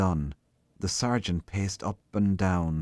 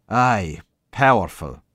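grim; 3 semitones higher, 8 dB louder, slower than usual. A narrator's reading. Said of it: "echoed the sergeant grimly".

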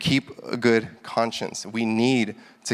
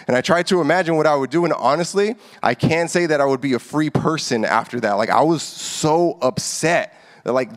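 normal talking voice

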